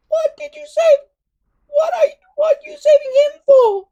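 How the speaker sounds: very high, loud, whiny little dragon shouting; a squeaky dragon crying out in protest; complaining Toothless. A narrator's reading. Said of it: "complained Toothless".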